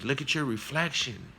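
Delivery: insistent tiger voice, rising in pitch; big tiger. Acted. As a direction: press the point as the big tiger. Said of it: "And he says".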